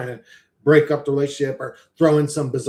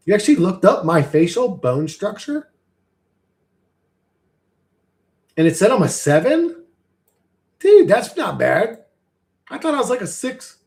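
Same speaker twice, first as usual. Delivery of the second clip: higher, louder, incredulous delivery; pleased disbelief; 6 semitones higher, 5 dB louder than usual. Real, not acted. Streamer told he is a seven, pleasantly amazed. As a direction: react in raised, amazed tones. Reacting to being rated seven.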